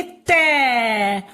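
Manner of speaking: तै held long, gliding down; drawn-out syllable. Shown as a demonstration. Teacher showing त with its matra, drawn-out तै. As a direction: stretch the vowel of तै. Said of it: "इसे हम बोलेंगे".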